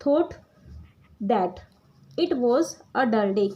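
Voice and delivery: word by word with long pauses between; teacher dictating while students copy; slow dictation with pauses. Shown as a demonstration. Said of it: "हम क्या लिखेंगे".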